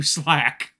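normal talking voice

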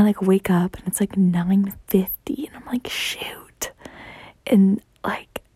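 hushed whisper while retelling. Real, not acted. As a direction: drop to a breathy whisper.

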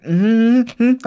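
high imitated hum through pain; re-enacted high-pitched hum; pained humming. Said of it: "I'm, like, humming".

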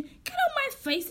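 very high, slow disbelieving question; drawn-out, high-pitched outraged echo; incredulous echo. Retold or imitated. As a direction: throw his words back in disbelief. Her incredulous echo of his words.

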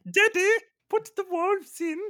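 put-on very high, squealing little-kid demand; little child. Child begging daddy to change the film, piping kid voice. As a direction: play an excited kid pestering daddy. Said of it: "little Roland".

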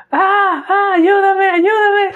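very high, loud mock scream for help; mother screaming for help. Son retelling his mother shouting for help, a high loud mock scream. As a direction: retell mom's panicked cry, hammed up and high. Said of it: "mi jefecita me grita".

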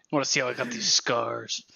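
low, soft mock Joker growl; a hushed, menacing Joker line; Joker impression. Friend teasing the group with a quiet Joker line. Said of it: "He's like the fucking Joker".

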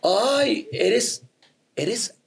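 drawn-out teasing voice; mocking friends voice. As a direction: tease him, stretching it out. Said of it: "Y todos".

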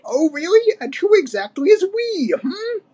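very high mocking old ape; Cranky, scoffing. A narrator's reading. Old ape mocking the youngsters' rescue plan, scornful. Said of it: "But Cranky just laughed".

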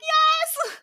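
very high, gleeful mock squeal; excited squeal. Newly engaged woman answering her friends, excited high squeal. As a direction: shriek it like a giddy new fiancée. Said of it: "they all just, like, scream".

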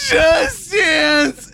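very high wailed mock sob; drunk crying. Shown as a demonstration. Bandmate finishing the drunk song, crying wail. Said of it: "like you're crying".

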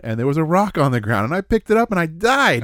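loud, high, mock-dramatic game story; hammed-up retelling. Telling a co-host a silly game story, mock-dramatic. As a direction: play up your character's absurd demise, voice climbing.

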